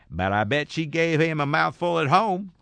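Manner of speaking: slow, high put-on Southern drawl of a wife; a wife gossiping at home in a high, slow Southern accent. Mocking his wife in a high, slow put-on Southern voice. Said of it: "I don't know what accent that was supposed to be".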